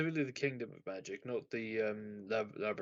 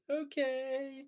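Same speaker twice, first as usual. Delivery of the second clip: sing-song high okay, lightly voiced; happy okay; 10 semitones higher than usual. Imitating the mother answering cheerfully, high and bright. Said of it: "like, happily".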